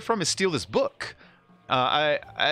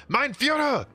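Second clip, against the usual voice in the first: soldier voice; slower than usual. Retold or imitated. Voicing soldier in retold sketch.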